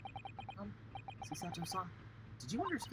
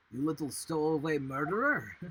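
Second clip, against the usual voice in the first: put-on loud gruff Russian sailor accent; suspicious Russian sailor, loud; 8 dB louder than usual. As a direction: bark it out in a thick Russian accent. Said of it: "I lost the accent".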